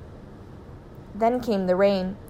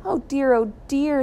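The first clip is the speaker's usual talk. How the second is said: dismayed; 5 semitones higher than usual. A narrator's reading. Mother dismayed at losing house.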